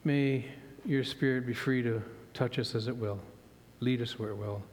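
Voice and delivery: soft, hushed prayer voice; a quiet opening prayer before the sermon. Acted. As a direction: pray it low and humble.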